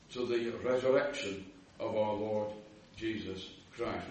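ordinary delivery

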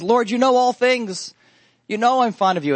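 high, pleading protest, acted; heart cry. Peter answers the Lord's third question with a heart cry. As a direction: plead it from the heart, pitched well up. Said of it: "the same heart cry that's coming from Peter".

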